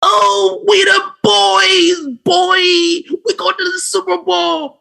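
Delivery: high, shouting, cocky fan voice; Cowboys fan. Pretend. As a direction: crow like a boastful football fan. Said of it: "Cowboys sound like this".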